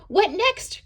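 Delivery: high, loud excited cry; a happy pencil crying out; cheerful and excited. A narrator's reading. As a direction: burst out with eager joy. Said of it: "cried the now cheerful and excited pencil".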